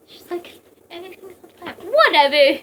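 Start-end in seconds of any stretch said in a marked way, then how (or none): 0.9-1.8 very soft, high little voice; tiny hushed voice. Retold or imitated. Mimicking a character amid flames, tiny high voice. 1.8-2.6 mock carefree exclamation. Mimicking someone unbothered by fire, loud carefree cry.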